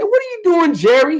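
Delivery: high, surprised shout of the name; startled call. Retold or imitated. Calling out to Jerry mowing his yard, surprised. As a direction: catch him in the act, amazed.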